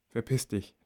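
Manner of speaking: Verpiss dich said under the breath as a thought; thought silently. A narrator's reading. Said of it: "denke ich".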